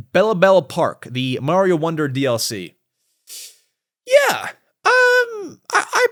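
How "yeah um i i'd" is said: excited squeal. Real, not acted. Excited about the Mario Wonder DLC.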